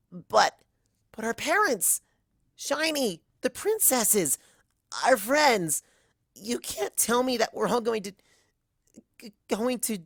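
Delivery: near tears. Acted. Other Twilight pleading about parents and friends, high and breaking. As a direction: beg it not to be true, voice rising.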